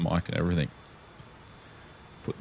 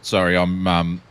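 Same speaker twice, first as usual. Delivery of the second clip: usual speaking voice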